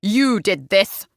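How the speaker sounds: slow, croaking accusation; heavy, shocked father's voice; croaked. A narrator's reading. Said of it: "he croaked".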